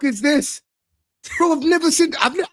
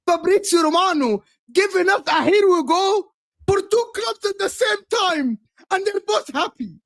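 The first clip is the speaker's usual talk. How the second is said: higher, near tears, shouting; outraged disbelief; 4 semitones higher than usual. Real, not acted. Chelsea fan to co-hosts over the double signing, outraged wail. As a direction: wail that this can't be real.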